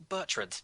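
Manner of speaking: Bertrand said the English way, BERT-rand; English reading. Shown as a demonstration. Co-host correcting the name to a plain English Bertrand. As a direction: Bertrand with the stress up front and a plain English ending. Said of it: "typical English name".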